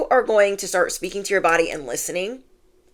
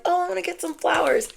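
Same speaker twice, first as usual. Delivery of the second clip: light excited self-quote; 11 semitones higher, faster than usual. Retold or imitated. Retelling her own thought.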